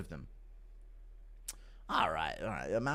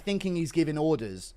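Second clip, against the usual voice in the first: ordinary delivery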